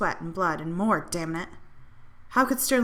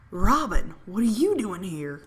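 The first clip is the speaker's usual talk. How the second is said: startled, louder on the name; surprised exclaim; 6 dB louder, slower than usual. Acted. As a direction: blurt it out, then slow down in disbelief. Bartender recognises Robin, startled.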